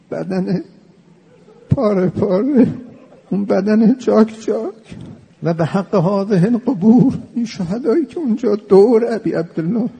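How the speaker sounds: keen over the torn body like a rowze reciter; mournful lament. Acted. Preacher leading a graveside ziyarat prayer, high wailing lament.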